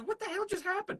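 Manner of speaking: cartoonish confused high voice; baffled bystander. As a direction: play a stunned onlooker who can't believe it. Acting out bewildered bystanders.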